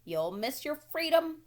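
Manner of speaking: loud, high and slow goose honk. A narrator's reading. Goose honking a warning at Wilbur, high and slow. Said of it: "honked the goose".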